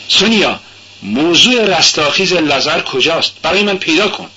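a man insisting his request be met; Raskolnikov's demand. Acted. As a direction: push her to find the passage.

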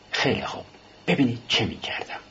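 a hushed, breathy whisper, barely voiced; whispering softly. A narrator's reading. Raskolnikov leaning close to the clerk, whispering. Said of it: "آهسته گفت".

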